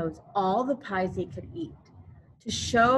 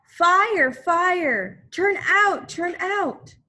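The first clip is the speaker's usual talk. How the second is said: high, loud fire call; ringing cry of fire, fire; alarm shout; 9 semitones higher, 6 dB louder, slower than usual. Acted. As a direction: shout the alarm over the bell.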